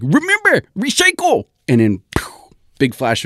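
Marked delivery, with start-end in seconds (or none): 0.0-1.5 very high, loud squeaky mad scientist call; zany professor exclaiming a last warning; professor's parting cry. Acted. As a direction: shout the farewell like a cartoon inventor. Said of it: "He's like".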